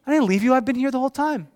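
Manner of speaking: high, loud, insistent comeback; defensive protest. Retold or imitated. Dad answers his weeping son at practice, high defensive protest. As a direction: protest your innocence to a crying child.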